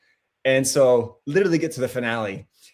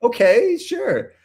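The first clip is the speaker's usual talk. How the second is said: baffled inner thought; 10 semitones higher than usual. Retold or imitated. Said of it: "I'm thinking to myself".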